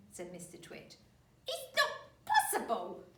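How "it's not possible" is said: put-on high, loud old woman; old wife voice. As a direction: squeak it out like a fussy old wife.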